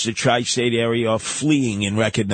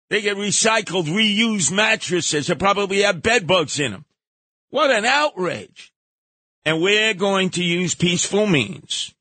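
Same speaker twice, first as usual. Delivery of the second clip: outraged rant; 7 semitones higher than usual. Real, not acted. Ranting about mattresses.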